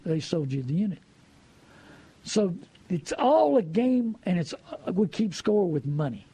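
ordinary talk

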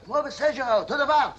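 high-pitched fighting zeal; rally like an old fighter; fired up. Acted. Old veteran urging a run like Sejera, fired up.

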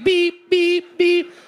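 high, loud mimicked seatbelt alarm beeps; mock car alarm beeping; imitated car beep. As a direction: squeal it out like the car's warning chime. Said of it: "it goes like".

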